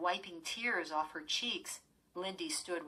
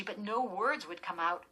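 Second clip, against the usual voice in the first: ordinary delivery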